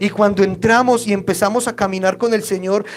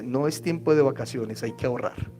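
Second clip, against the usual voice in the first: quiet voice of God; 6 semitones lower, 7 dB quieter than usual. Acted. God answers the believer.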